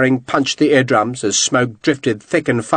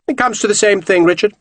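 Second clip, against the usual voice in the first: cheerfully; 8 semitones higher than usual. A narrator's reading. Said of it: "Lavasser said cheerfully".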